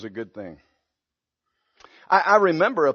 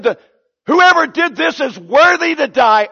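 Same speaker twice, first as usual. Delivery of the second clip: louder, higher furious verdict; David boiling over; righteous indignation; 8 semitones higher, 6 dB louder than usual. Retold or imitated. David condemning the rich man in a rage. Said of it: "righteous indignation was boiling over".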